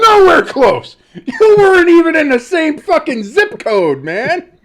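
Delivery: loud, high teasing yell; mocking shout. Real, not acted. Teasing his co-host loudly about a bad guess, mocking shout. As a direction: razz him hard for the miss.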